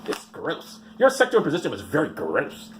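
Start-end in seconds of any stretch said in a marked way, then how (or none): 0.1-0.8 whispered disgust. Real, not acted. Comic repeating the charge in a whisper. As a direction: breathe it out in a whisper.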